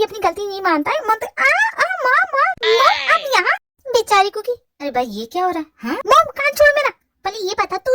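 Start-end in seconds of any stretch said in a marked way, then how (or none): 1.1-3.6 high yelps of pain. Acted. Brother crying out while sister twists his ear, high yelps. 6.1-6.9 higher, louder pleading cry; pained plea. Acted. Son begging mom to let go of his ear, loud high plea.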